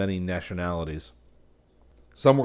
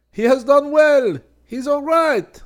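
much higher, loud cheering shout; shouting crowd; 12 dB louder, slower than usual. A narrator's reading. The studio cheering Selby, shouting. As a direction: cheer it out as a whole room.

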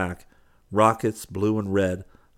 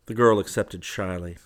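ordinary delivery, nothing marked

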